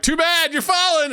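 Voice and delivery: high-pitched dream heckler; mock alarm. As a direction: cry it out high, as in a falling dream. Dream voice taunts him while falling.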